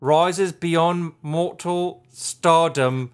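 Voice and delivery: slow, loud British slipping into Australian; hammy British accent. Pretend. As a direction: proclaim it in overblown fake British. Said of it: "with a British accent".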